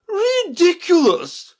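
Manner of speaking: loud, drawn-out, very high exclamation; indignant cry. A narrator's reading. Red Queen cutting Alice off, loud scornful cry. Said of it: "cried the Queen".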